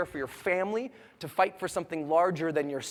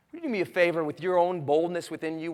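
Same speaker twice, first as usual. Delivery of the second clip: ordinary talk, nothing marked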